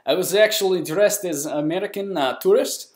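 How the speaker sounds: hammed-up Russian accent. Shown as a demonstration. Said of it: "Now you get my Russian accent".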